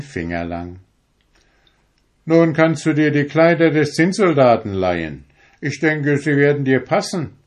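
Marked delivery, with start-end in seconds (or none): 2.2-7.4 brisk Sandman. A narrator's reading. Sandman dresses Friedrich.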